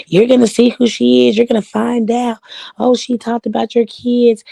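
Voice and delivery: mocking gossip voice. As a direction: sneer it like the woman did. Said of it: "it was a woman".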